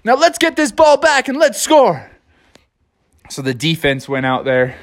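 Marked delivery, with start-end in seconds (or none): 0.0-2.1 Froggy hyped, shouting to his receiver; Froggy cheering. Acted. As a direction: celebrate the touchdown. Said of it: "And Froggy's like".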